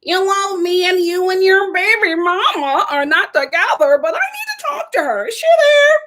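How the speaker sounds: whiny insistence climbing to a squeak; mocking her demand to speak to the baby mama; imitating her. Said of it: "she's just like".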